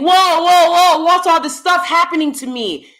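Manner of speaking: high, loud whining mock victim; mock helpless victim. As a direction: play the clueless victim crying whoa. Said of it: "is saying".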